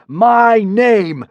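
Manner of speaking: loud, high, resounding god voice; booming god voice. A narrator's reading. Hiccup posing as a god booming at the Romans. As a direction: boom it out like a thunder god. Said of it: "boomed Hiccup".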